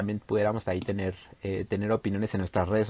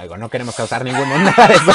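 laughing; 12 dB louder, faster than usual. Real, not acted. Joking about couples separating.

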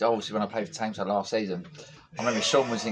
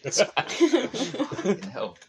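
drawn-out laughing curse; 9 semitones higher, much slower than usual. Real, not acted. Reacting to the toilet joke, laughing through the curse. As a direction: laugh the words out, very slow.

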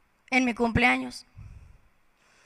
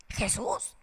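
ordinary talk, nothing marked

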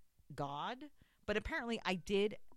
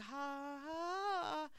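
high, drawn-out mock moan; sustained fake whimper on an open breath; letting out sound; 7 semitones higher than usual. Obeying his order to let out the sound, performed moan. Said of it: "Let out the sound".

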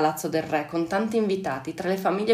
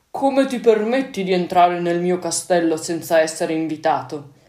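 a lightly voiced stern ogre, a bit louder; 4 dB louder than usual. A narrator's reading. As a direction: scold the intruder like a mildly gruff ogre. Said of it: "gli chiese l'orco severo".

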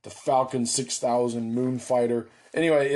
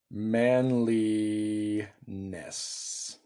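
MANLINESS stretched very slowly; drawn out; 3 semitones lower, 4 dB quieter, much slower than usual. Real, not acted. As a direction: drag out each syllable of MANLINESS while writing. Drawing out the word while writing it.

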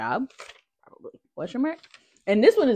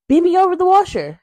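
excited; 11 semitones higher, 7 dB louder than usual. Real, not acted. Excited fantasy.

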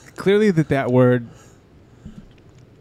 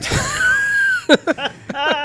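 shrieking laugh. Real, not acted. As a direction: howl with laughter. Reacts to braid joke.

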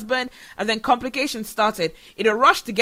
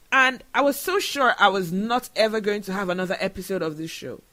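ordinary delivery

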